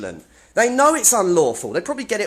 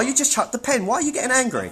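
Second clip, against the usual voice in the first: goading; 7 semitones higher than usual. Real, not acted. Goading staff who threw a pen.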